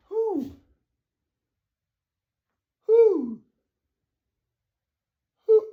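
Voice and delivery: high drawn-out who call; high owl hoot. Retold or imitated. Lucifer hooing at God, high hoot.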